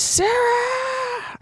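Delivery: a very high cry of "Sarah!"; shout. Acted. Sister calls out to Sarah in danger, high shout. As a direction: cry out the sister's name in panic. Character screams sister's name.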